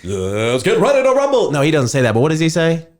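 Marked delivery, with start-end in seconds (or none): 0.0-1.5 booming high ring announcer voice; arena announcer hyping the crowd; Bruce Buffer announcer call. Retold or imitated. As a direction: belt it like a fight announcer. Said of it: "Bruce Buffer, the announcer, was like".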